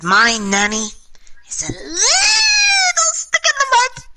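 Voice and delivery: put-on very high, slow, squealing kid voice; high squeal breaking into a cry; drunk child wail. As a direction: wail it like a drunk little girl. Drunk kid judging her grandmother.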